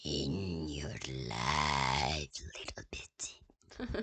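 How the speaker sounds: eerie unvoiced whisper; creepy whisper. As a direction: whisper it like a lurking creature.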